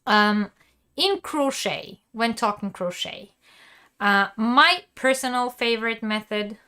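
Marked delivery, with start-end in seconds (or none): none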